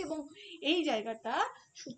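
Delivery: ordinary talk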